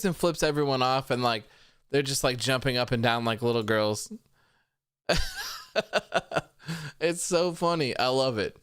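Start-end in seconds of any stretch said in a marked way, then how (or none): 5.1-6.4 shrieking laugh; high squeal of laughter. Real, not acted. Laughing at Maki Ito clip.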